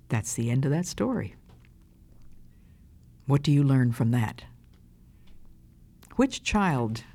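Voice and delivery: plain reading voice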